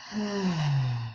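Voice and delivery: long sighing breath out; audible exhale. Shown as a demonstration. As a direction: let the breath out with a soft, noisy sigh. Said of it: "feel kinda good to make a noise".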